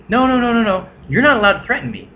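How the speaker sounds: high, fast, whiny protest mimicking the roommate; mock panicked denial. Narrator mimicking roommate protesting threat, high whiny mock. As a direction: ham up a panicky no-no-no.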